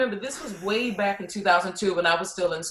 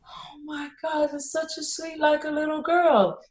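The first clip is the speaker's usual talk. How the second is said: imitating her child; 8 semitones higher than usual. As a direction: gush like a small kid seeing something sweet. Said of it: "their reaction is like".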